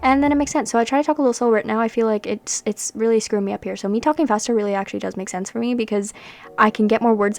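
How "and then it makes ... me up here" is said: normal talking voice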